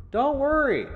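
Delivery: high, loud echoing call; father shouting from afar; distant shout. A narrator's reading. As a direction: call from the other end of a bunker. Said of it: "sounded like he was at the other end".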